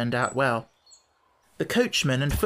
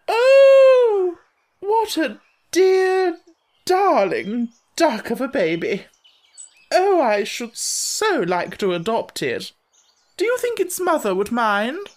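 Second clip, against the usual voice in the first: high, gushing society lady, much higher and louder; gushing grand lady; 14 semitones higher, 6 dB louder, slower than usual. A narrator's reading. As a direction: coo over the baby like a grand lady. Lady character speaks.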